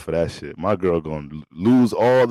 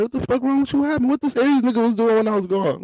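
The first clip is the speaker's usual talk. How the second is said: angry friends; 14 semitones higher, faster than usual. Retold or imitated. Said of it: "Be like".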